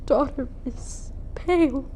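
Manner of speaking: tragic stage monologue, swelling and sinking; grieving noblewoman, dramatic. Acted. Lady Capulet stands over her daughter's body, grieving. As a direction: lament with theatrical weight and long pauses.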